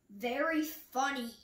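ordinary delivery, nothing marked

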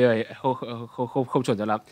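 ordinary talk, nothing marked

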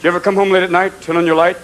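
ordinary talk, nothing marked

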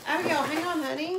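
calling out. Real, not acted. Mom calling to her daughter.

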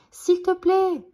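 high, loud plea; desperate shout. A narrator's reading. Billy begs the galloping bison, desperate shout. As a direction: cry out at the end of your strength. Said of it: "crie Billy à bout de force".

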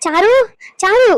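sharp rising call of a name. Acted. Grandmother summoning her grandchild, calling out. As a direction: call the girl over, voice lifting at the end. Grandmother calls the girl over.

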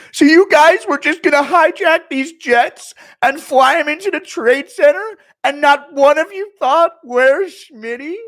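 high, very loud indignant rant; Schmitty rant. Retold or imitated. Streamer as Schmitty scolding partygoers, loud high rant. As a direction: yell it like a wounded ego. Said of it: "do Schmitty during nine eleven".

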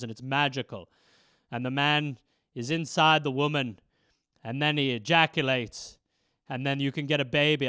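a hint of low, earnest father; gentle dad. Dad explaining to his son, low.

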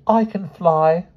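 put-on high, slow girl's confession; wretched child, pitched high; miserable. A narrator's reading. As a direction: own up unhappily, dragging it out. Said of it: "she said miserably".

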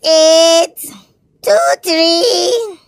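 ordinary talk, nothing marked

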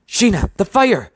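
high, loud warning shout; high-pitched urgent yell; alarmed cry. A narrator's reading. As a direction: cry out an emergency. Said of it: "I cried".

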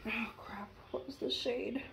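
breathy whisper under her breath; whispered oh crap. Real, not acted. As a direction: mutter it to yourself, all air. Forgot the shade on camera.

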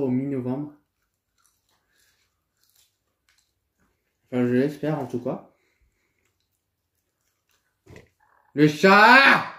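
chewing while talking; speaking between bites; mouth full of fries. Real, not acted. Seen 0: Puts fries in mouth at 5.4s, then chews through the end.

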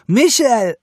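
high, loud cry of his name; almost scream it in outrage; near scream. A narrator's reading. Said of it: "Sie schrie fast".

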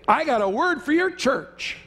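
slow, high, mock-grand prophetic announcement; bold caller. Caller telling an unknown pastor about a word, slow and grand. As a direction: ham up a stranger's bold claim. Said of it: "you call them up and you say".

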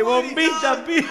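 high catchphrase cried through laughter; mock cinema yell. Marcelito's cry echoing through the cinema, laughing mock yell. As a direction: imitate the shout, cracking up. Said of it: "Y sonaban todo el coso".

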